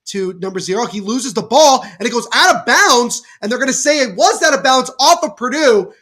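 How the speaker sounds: brisk live game call, rising on the big plays; lively sports commentary. Acted. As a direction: call the action as it happens.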